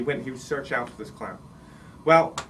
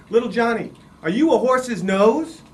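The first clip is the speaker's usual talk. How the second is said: head clown; 8 semitones higher, 8 dB louder than usual. Acted. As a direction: set up the gag for the whole tent. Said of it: "He said".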